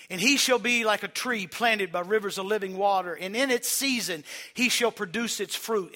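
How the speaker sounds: loud, ringing scripture reading; scripture read aloud. Shown as a demonstration. Pastor shows how to read the Bible aloud, loud and ringing. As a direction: proclaim the psalm out loud. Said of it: "and speak it out loud".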